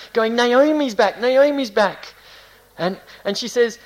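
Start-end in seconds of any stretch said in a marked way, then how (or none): 0.4-2.1 high, loud excited calls. Acted. Townspeople welcoming Naomi home, excited. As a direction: spread the news like thrilled townsfolk. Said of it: "her people are excited".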